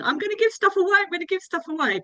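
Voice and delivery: high, eager teasing voice; squeaky excited quote; imitating an excited actress. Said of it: "she kept saying".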